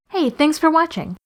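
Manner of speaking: usual speaking voice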